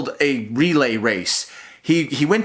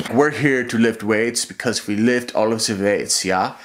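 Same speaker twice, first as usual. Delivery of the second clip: mocking the gym bros in a lower, dopey voice; lunkhead gym bro; 7 semitones lower than usual. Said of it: "They are, like".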